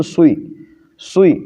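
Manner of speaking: سوئی said with rounded lips and an extra w glide; wrong rounded swee. Shown as a demonstration. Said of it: "وی کی آواز لگا کر کے سوئی".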